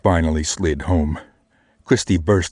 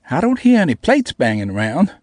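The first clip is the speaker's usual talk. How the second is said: put-on high, louder teasing girl voice; 10 semitones higher, 4 dB louder than usual. A narrator's reading. Namie ribbing him through the speakers, high and teasing. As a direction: poke fun in a high little voice. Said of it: "she said".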